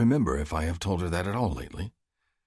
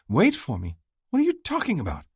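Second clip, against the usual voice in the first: higher female voice, rising to a startled question; can't believe what he's saying; bewildered Stacy; 11 semitones higher, 3 dB louder than usual. Acted. Stacy confused.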